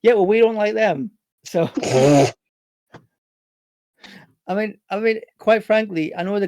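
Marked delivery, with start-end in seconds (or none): 0.0-1.1 louder, higher comeback over the radio; character retorting over radio, louder; snippy retort. Acted. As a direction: snap back through the radio.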